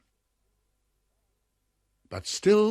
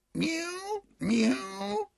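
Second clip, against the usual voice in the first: very high pleading mews; cat crying mew. Acted. Simpkin mewing high at the locked door. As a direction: cry out like a shut-out cat. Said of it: "cried Simpkin".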